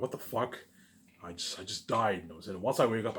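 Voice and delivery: ordinary talk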